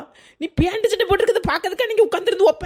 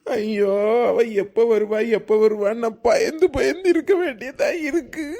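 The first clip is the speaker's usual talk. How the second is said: comic wailing; 7 semitones lower than usual. Acted. Scared husband wails.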